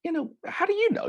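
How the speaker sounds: put-on a quick, put-out squeak of a young caller; mock young caller. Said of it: "kinda like".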